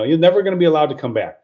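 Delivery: rattled off quickly so it sounds good; said fast. Shown as a demonstration. Showing how the promise sounds if said quickly, rushed. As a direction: rush the line so nobody hears the catch. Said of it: "you say it fast and it sounds good".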